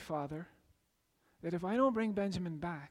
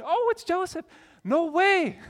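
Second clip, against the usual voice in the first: much higher, louder gleeful cry; mock joyful surprise; 9 semitones higher, 7 dB louder than usual. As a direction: cheer like it's a party. Pictured celebration of Joseph's return.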